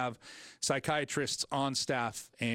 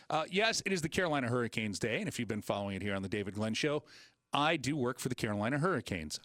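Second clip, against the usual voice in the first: ordinary talk, nothing marked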